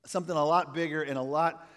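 normal talking voice

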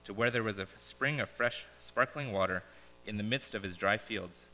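solemn parable reading with pauses; story narration. Acted. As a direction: read it like a gentle fable to the congregation. Reads a parable aloud.

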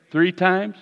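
ordinary talk, nothing marked

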